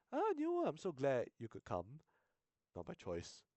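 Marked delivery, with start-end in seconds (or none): 0.1-2.0 chirpy counselor greeting. Acted. Counselor greeting the new student, chirpy high hello. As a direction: gush it out cheerfully.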